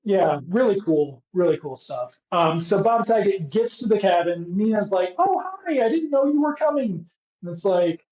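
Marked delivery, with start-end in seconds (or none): 5.2-7.0 much higher voice, acting out a woman's hello; high-pitched mock greeting. Woman greets an unexpected visitor at the cabin, high-pitched mock surprise.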